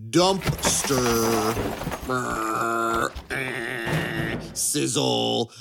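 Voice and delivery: drawn-out announcer reveal with sound-effect noises; mock segment announcement. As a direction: stretch every syllable out like a hammed-up jingle sting.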